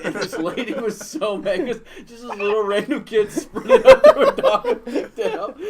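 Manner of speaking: normal talking voice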